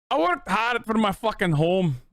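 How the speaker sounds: a grumpy Scottish homeowner, badly faked; mock Scottish accent. Streamer trying a Scottish line for chat in a mock accent. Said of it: "I'll do Scottish".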